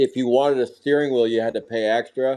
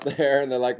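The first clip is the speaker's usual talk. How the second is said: ordinary delivery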